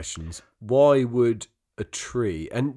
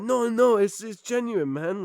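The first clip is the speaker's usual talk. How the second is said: mock defensive dealer; 11 semitones higher, 4 dB louder than usual. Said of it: "the guy's like".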